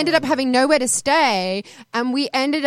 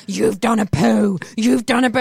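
accusing; 5 dB louder than usual. Retold or imitated. Manager accusing, slow.

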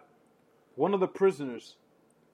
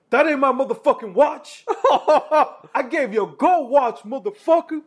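mock furious inmate; 10 semitones higher, 11 dB louder than usual. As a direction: roar at him, half laughing. Inmate accusing over the watch.

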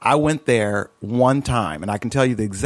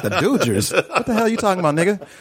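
laughing through it, higher and fast; mock outrage while cracking up; laughing disbelief; 8 semitones higher than usual. Mocking friend's Dodgers love.

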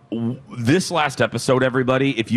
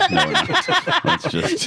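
laughing denial, higher; slower than usual. Real, not acted. Host laughing off Second Chance Romance, higher pitch. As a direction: laugh through the no.

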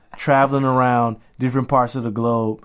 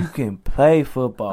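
slow boxer impression; Deontay Wilder. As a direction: draw out the word play, mimicking a boxer. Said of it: "What my man Deontay Wilder say?".

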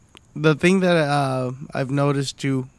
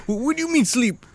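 mock baffled; 10 semitones higher, faster than usual. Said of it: "looking all fucking cracked out".

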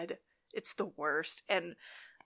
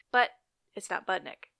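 usual speaking voice

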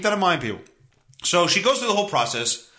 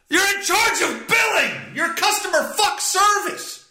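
indignant shout; 13 semitones higher than usual. Real, not acted. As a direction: yell it in disbelief. Ranting about the billing clerk.

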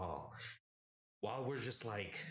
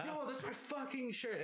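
high outraged shout; angry yell. Retold or imitated. Yelling at roommate grabbing his shirt, high outraged.

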